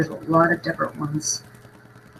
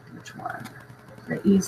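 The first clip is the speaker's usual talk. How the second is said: whispering; 3 semitones higher, 6 dB quieter than usual. Real, not acted. Reading model number to herself.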